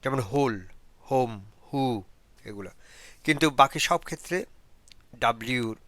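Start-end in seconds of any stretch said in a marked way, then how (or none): 0.3-1.8 "হোল" as "hole" and "হোম" as "home" with a clear h; "হু" said "hoo" with the h and no w; sounded h. Shown as a demonstration. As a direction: demo h spoken in whole, home, who. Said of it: "হো, ও বা উ উচ্চারিত হয়".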